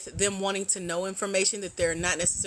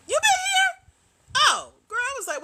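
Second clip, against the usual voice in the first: excited squeal; 6 dB louder than usual. Real, not acted. Excited squeal greeting a returning viewer.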